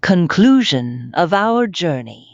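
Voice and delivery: loud, bold title announcement; announced title. Real, not acted. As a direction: announce the heading boldly.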